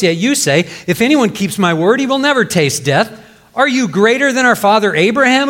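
lightly voiced angry crowd; indignant accusers. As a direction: demand it of him in outrage.

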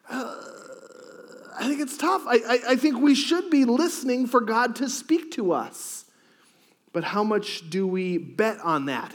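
ordinary talk, nothing marked

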